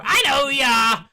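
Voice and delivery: high, loud shouting guard; angry guard. Acted. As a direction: shout them away from the door.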